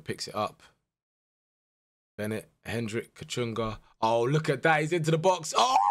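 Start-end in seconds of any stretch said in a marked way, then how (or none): none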